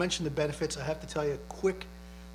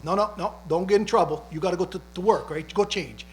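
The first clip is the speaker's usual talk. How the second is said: louder, higher fatherly insistence; father pushing son to go to work, louder and higher; mock worried father; 4 semitones higher, 7 dB louder than usual. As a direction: play the fussing dad urging his son off to work.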